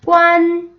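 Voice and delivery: 관 held high, level and loud; high first tone. Shown as a demonstration. Teacher showing first tone, 관 high and level. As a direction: sustain 관 on one high note. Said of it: "일 성".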